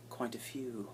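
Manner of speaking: a sly whispered aside. Real, not acted. YouTuber whispering a joke about few viewers. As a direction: whisper the punchline under your breath.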